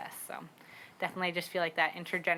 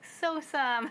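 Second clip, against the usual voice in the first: much higher, a toddler's imitation of the word; 9 semitones higher than usual. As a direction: copy a little kid saying it with a scrunched face. Daughter makes a face, says it.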